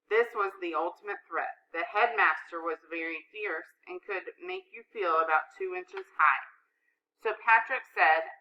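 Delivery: ordinary delivery, nothing marked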